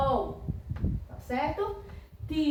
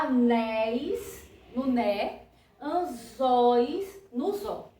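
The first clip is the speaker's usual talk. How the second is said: slow, leaning on "né" in "Anéis" and "zó" in "anzóis"; slow teacher stress demo; stressed syllable shown; slower than usual. Shown as a demonstration. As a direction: point out the stressed syllable slowly. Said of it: "Que eu vou ter que acentuar".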